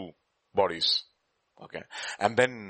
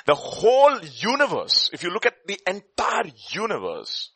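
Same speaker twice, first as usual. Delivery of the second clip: preaching; 8 semitones higher, 5 dB louder than usual. Acted. Preaching the fine-tuned universe.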